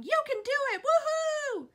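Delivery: high, loud cheering yell. Retold or imitated. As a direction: cheer her on from the crowd. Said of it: "Janice distracted me by yelling".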